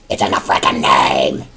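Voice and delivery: loud, slow exasperated blast; fed-up yell at the newbie; angry outburst. Acted. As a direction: snap it out like it's painfully obvious. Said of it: "No need to get angry".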